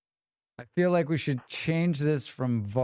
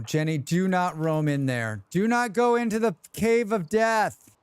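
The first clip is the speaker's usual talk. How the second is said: calling out; 6 semitones higher, 3 dB louder than usual. Acted. Calling to wandering colonist.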